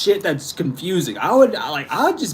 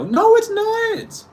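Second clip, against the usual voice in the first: a drawn-out, much higher "No"; incredulous denial; much slower than usual. Retold or imitated. Scoffing at the rule-makers in a drawn-out high no. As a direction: stretch out the No in disbelief. Retorting to imagined person.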